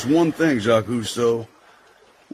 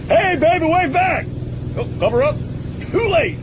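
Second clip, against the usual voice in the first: calling out. Acted. Calling out to sunbathing girl.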